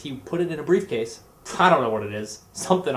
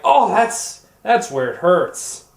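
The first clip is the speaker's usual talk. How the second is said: higher and louder cry, dragged out slowly; mock pained yelp; 5 semitones higher, 8 dB louder, slower than usual. To the nurse pressing his arm, a hammed-up pained yelp. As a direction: yelp as if the nurse hit the sore spot. Nurse squeezes the sore spot.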